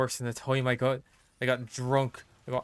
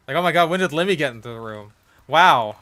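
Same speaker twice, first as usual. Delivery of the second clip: loud, fast, hammed-up shock; playful fake astonishment; mock surprise; 7 dB louder than usual. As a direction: act floored someone just appeared. Shocked by Limmy's sudden appearance.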